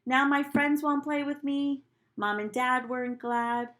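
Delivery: usual speaking voice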